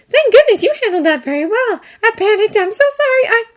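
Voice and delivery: high, fluttery apologetic woman's voice; a fairy gushing nervous apologies; flustered fairy. Acted.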